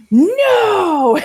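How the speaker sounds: high, sharp mock cry of alarm; fish shout. Betta mistaking a guppy for a rival, outraged shout.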